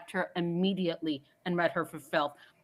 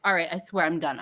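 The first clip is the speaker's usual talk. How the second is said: normal talking voice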